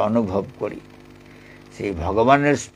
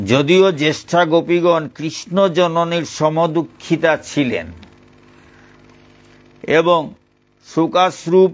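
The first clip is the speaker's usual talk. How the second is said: louder, higher reading-aloud voice; scripture reading; 5 semitones higher, 4 dB louder than usual. Acted. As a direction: read the holy text out to listeners. Reads the Bhagavatam commentary aloud.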